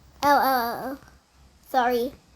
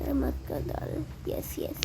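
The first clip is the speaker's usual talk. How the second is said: hushed whisper, barely voiced; 7 dB quieter than usual. Real, not acted. Hiding from Granny, player whispers to himself. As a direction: breathe the words so Granny can't hear.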